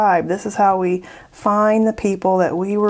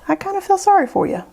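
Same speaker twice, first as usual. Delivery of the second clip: much higher, fast, sing-song pity; mock pity; 10 semitones higher, faster than usual. To people who can't have fun making art: high, quick, teasing mock pity. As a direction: tease them with exaggerated sympathy. Mocking joyless art critics.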